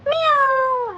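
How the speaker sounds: high cat mew; cat meow. Acted. Telling children what the cat says, high meowing. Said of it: "It says".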